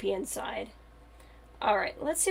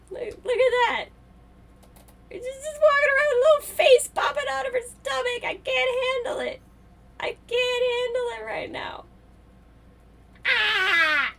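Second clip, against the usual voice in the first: much higher, louder squeals of joy; squealing with delight; 14 semitones higher, 5 dB louder than usual. Real, not acted. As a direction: squeal like you just saw the cutest thing ever. Baby koala face in the pouch.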